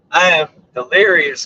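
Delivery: usual speaking voice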